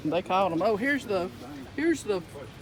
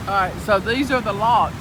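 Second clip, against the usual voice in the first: raised over the boat; talking over the engine; 9 dB louder than usual. Real, not acted. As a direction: call it out over the motor noise.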